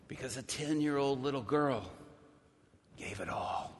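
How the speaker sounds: whispered climax of the story; hushed whisper. Acted. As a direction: drop to a whisper on gave it all.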